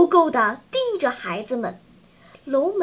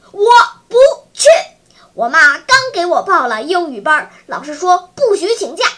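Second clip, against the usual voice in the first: jumping-up refusal; 2 semitones higher, 8 dB louder, faster than usual. A narrator's reading. Chubby boy refuses to join the haunted-house outing, loud protest. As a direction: refuse at full volume and pile up reasons fast. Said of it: "小胖子第一个蹦了起来".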